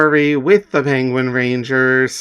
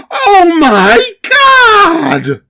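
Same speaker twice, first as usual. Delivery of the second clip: horrified scream; 9 dB louder, much slower than usual. Acted.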